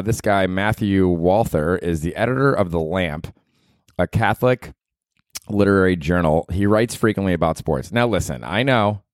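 usual speaking voice

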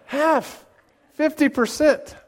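high-pitched, punchy mock disbelief; incredulous. Real, not acted. Preacher repeating the congregation's answer back, high and incredulous. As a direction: throw it out high and astonished. Still astonished at audience's answer.

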